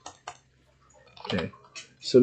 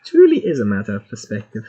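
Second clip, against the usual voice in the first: lower-pitched light German accent; German accent attempt; 4 semitones lower, faster than usual. Shown as a demonstration. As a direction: slip into a modest German accent. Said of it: "that's better than what I did the first time".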